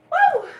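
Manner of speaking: high loud "woo" to shake off tears; sudden whooping release of emotion; big high exhale-whoop; emotional whoop. Real, not acted. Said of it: "I'm so emotional".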